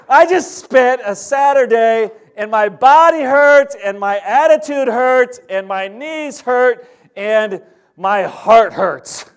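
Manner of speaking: exasperated griping about a lost Saturday, slowing down; ticked-off rant. Retold or imitated. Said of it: "now I'm, like, really ticked".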